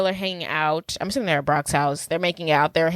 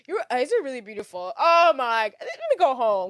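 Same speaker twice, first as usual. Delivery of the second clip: higher, hammed-up fed-up complaint; mock exasperation; 6 semitones higher than usual. Co-host voices the annoyed girl on a date, exasperated. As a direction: play her as dramatically over it. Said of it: "And she's, like, pissed".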